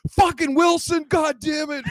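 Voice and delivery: high, loud imitated fan outburst; football fan yell. Seahawks fans interrupting a comic's setup, mock furious yell.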